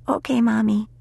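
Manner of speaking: put-on high-pitched child's reply; little girl's voice. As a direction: answer Mommy like a young daughter. Young daughter answers her mother.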